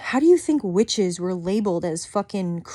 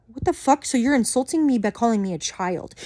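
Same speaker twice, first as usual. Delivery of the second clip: usual speaking voice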